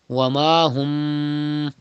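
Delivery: Wa ma hum slow, the mim hummed nasally; slow recitation of Wa ma hum; ikhfa on mim. Shown as a demonstration. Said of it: "do gunna in mim sakin".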